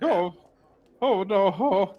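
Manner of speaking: loud, high comic wailing; hammed-up panic. Failed actor backing off, calling to Dad, in hammed-up panic. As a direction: wail out loud while backing away. Bandit flees home in panic.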